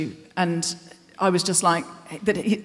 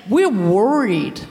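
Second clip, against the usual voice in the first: put-on sinister Canadian accent; slower than usual. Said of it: "make that accent sinister".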